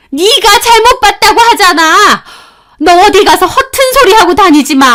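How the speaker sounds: high, loud menacing teacher; threatening warning. Retold or imitated. Teacher threatening the student, high and loud. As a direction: warn her off, hard. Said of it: "경고하시더라고요".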